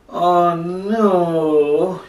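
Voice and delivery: high, drawn-out dramatic groan; groaning dismay. Acted. Jonah hears God's order to go to Nineveh, groaning. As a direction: wail it in exaggerated dismay. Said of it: "he groaned".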